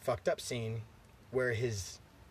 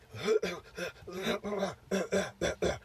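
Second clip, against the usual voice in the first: imitated coughing fit; 7 semitones higher than usual. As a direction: hack repeatedly like someone fighting for air. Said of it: "coughing her fucking head off".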